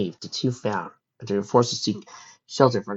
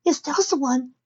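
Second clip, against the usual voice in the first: put-on young filly voice. Fireside's voice.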